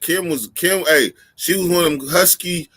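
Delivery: ordinary talk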